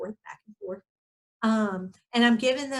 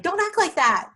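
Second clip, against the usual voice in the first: sharp scolding; 9 semitones higher, 3 dB louder than usual. Retold or imitated. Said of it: "I'm not saying things like".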